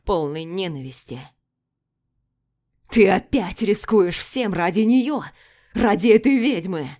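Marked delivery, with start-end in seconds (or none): 2.9-6.9 booming like thunder. Acted. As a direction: thunder it under the vaults. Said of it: "Его голос раздался громом".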